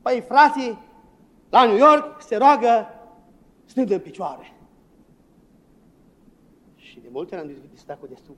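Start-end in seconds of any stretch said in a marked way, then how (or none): 0.0-4.5 mock critic. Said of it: "am fost criticați".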